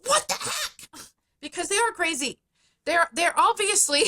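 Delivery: very high-pitched outraged parent act; mock screaming. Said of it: "you're coming in screaming".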